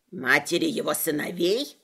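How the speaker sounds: low sly leprechaun. Acted. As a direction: play the pedantic leprechaun low. Leprechaun voiced by narrator.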